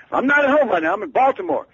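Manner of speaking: high, loud, brusque demand; high-pitched, loud impersonation of a famous singer on the phone. Retold or imitated. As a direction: bark it down the phone like a star. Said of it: "shouting so loudly".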